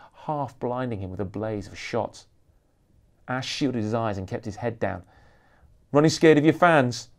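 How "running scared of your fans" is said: loud, jeering and higher; taunting. Acted. Paparazzo jeering at the rock star, loud taunt. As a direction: goad him to get a reaction. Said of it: "taunted another pap".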